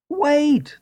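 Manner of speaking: high shouted call of a rooster; rooster shouting. A narrator's reading. Rooster warns the donkey, high shouted call. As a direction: cry out a warning, way up high. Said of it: "the rooster shouted".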